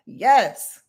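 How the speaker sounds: ordinary talk, nothing marked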